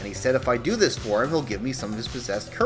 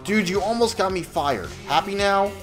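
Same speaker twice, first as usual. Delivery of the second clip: high, loud, annoyed outburst; fed up and accusing; exasperated scolding; 8 semitones higher, 5 dB louder than usual. Acted. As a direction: tell him off, raised voice. Todd angrily confronts demon.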